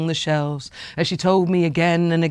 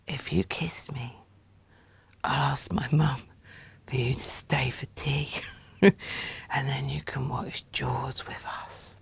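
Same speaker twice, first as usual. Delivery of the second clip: whisper; 3 semitones lower, 10 dB quieter than usual. A narrator's reading. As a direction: coax in a secret whisper. Child bargaining, whispered.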